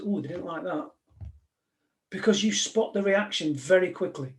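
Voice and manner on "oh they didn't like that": soft, far-off voice in your head; inner voice. Retold or imitated. The voice in your head noticing a bad reaction, softly. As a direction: murmur it like a thought to yourself. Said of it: "the voice in your head says".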